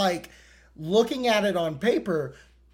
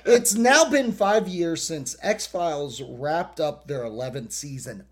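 ordinary delivery

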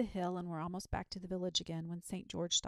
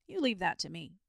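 assured, louder promise; confident; 7 dB louder than usual. A narrator's reading. Saint George asking the dragon to trust him, confident. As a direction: take charge with easy certainty. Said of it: "said Saint George with confidence".

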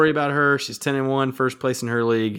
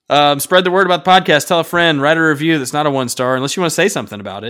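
louder, upbeat plug for the show; promo read; 4 semitones higher, 7 dB louder than usual. Acted. Hosts plugging the podcast to listeners, upbeat ad read. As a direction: pitch the podcast like an ad.

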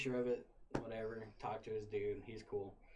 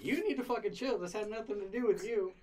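angry yell; 9 semitones higher, 5 dB louder than usual. Retold or imitated. Yelling at roommate in fight.

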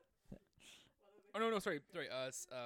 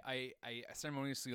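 ordinary talk, nothing marked

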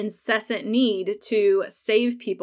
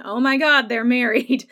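much louder, higher gasp of disbelief; shocked fan; 3 semitones higher, 6 dB louder than usual. Retold or imitated. Viewer reacting to the surprise marriage, shocked and loud. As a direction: blurt it as if the news just landed.

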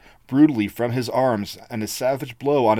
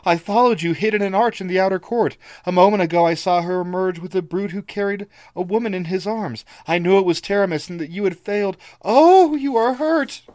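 put-on higher, breathless worried girl; frantic girl; 8 semitones higher, 4 dB louder than usual. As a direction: pour it all out in a rush. Ivga frantic.

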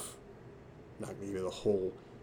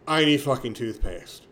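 louder, loopy, slurring the words out; drugged slurred demand; 3 semitones higher, 7 dB louder than usual. Retold or imitated. To the desk clerk, what actually came out, slurred and louder. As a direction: blurt it out woozy and loud. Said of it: "But what came out was".

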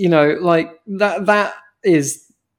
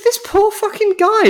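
exasperated. Retold or imitated. Retelling own outraged reaction.

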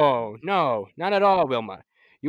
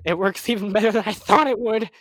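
loud, higher, overjoyed; thrilled inventor; delighted; 5 semitones higher, 5 dB louder than usual. Acted. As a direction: gush with pride. Invention succeeds.

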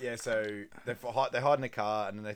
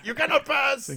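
very high, loud proclamation; grand imitated battle cry; mock commanding shout; 11 dB louder than usual. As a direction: bellow a theatrical refusal, hammed up. Mimics film character's booming refusal.

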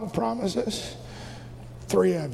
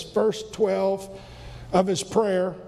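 normal talking voice

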